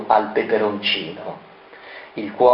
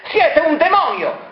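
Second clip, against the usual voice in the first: much higher, shrill accusation; accusing. Acted. Doctor Negri, possessed, denounces Variconi. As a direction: spit out the accusation. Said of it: "proseguì".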